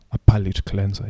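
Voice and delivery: normal talking voice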